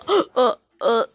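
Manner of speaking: loud mimicked grunts; short, punched "uh" sounds; mocking stammer. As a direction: imitate someone stuck for words, loudly. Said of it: "He's like".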